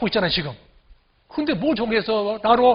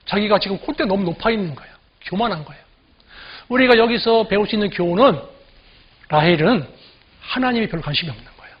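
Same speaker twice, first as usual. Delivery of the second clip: ordinary delivery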